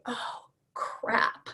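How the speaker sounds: whispered, deflated mutter; dismayed aside. Retold or imitated. Wife realizing her plot is Titanic, whispered dismay. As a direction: let it drop out under your breath. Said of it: "I'm going".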